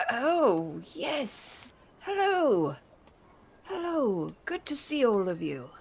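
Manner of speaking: put-on older Dragonborn shopkeeper welcomes the party into his shop. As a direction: greet them like a doting old merchant. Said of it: "older Dragonborn".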